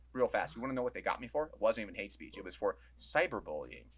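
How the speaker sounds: normal talking voice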